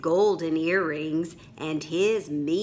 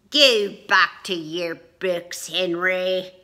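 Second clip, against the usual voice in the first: dismissive pirate; 7 dB louder than usual. Acted. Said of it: "Jean dismisses".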